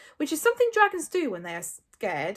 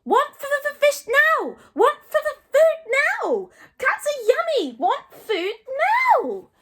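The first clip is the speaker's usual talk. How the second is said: high, loud dragon tantrum; squeaky, stuttering full-volume demands; Toothless shouting; 10 dB louder, slower than usual. A narrator's reading. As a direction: screech the demands like a greedy little creature.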